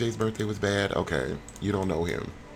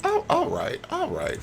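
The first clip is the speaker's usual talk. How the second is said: mock squeal. Real, not acted. Mock reaction in high voice.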